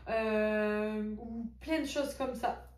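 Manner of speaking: speaking through hiccups; words caught by a hiccup. Real, not acted. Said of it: "j'ai le hoquet". (voix coupée par le hoquet)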